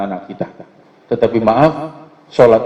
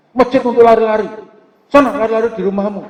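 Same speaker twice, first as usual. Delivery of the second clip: mock scolding elder; 9 semitones higher than usual. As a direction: snap at the children like a cranky elder. Mosque elder scolding noisy children.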